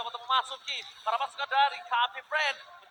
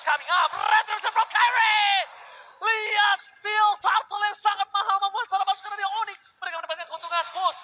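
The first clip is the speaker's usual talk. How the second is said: hyped esports play-by-play shout; fighting game announcer; 8 semitones higher, 10 dB louder than usual. Acted. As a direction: yell the big steal call. Live esports casting climax.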